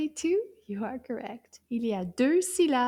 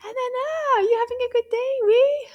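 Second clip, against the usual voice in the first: high, loud, delighted greeting; excited greeting; 10 semitones higher, 8 dB louder, faster than usual. Pretend. Teacher greeting Anana as she arrives, excited and loud. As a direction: greet a little friend with big delight.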